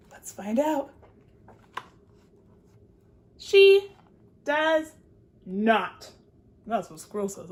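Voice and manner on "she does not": loud then falling, word by word; She... does... not, spaced and weighted; dramatic pause. Shown as a demonstration. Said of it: "dramatic reveal".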